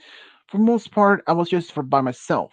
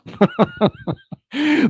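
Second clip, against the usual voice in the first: embarrassed high laugh. Real, not acted. Embarrassed about childhood play.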